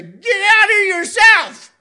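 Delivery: high, loud, strained protest; a loud mock yell of frustration; exasperated outburst. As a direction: shout back like you can't breathe. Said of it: "I wanna just stop and say".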